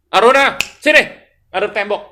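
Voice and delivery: high, loud scolding command; imitated angry teacher. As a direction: order a pupil over like a cross teacher. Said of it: "Guru saya kalau marah". (menirukan guru marah, membentak)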